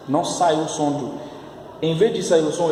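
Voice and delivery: ordinary talk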